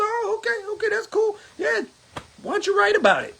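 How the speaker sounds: high, breezy mock okay; mock agreeable. Answering the clerks' complaints, high mock-breezy retort. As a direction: brush it off with a high sarcastic okay.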